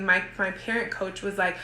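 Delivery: normal talking voice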